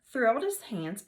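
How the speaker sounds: ordinary delivery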